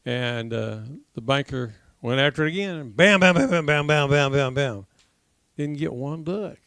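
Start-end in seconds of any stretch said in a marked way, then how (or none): 3.0-4.9 rapid volley of mock gunshots; louder burst of bams, easing off; imitated gunfire. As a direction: rattle off bams like shots. Imitates second round of gunfire.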